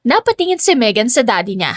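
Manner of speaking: ordinary delivery, nothing marked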